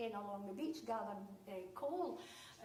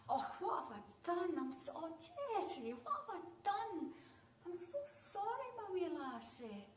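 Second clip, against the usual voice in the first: fussing upset mum; 6 semitones higher than usual. Retold or imitated. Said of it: "mum apologising in distress".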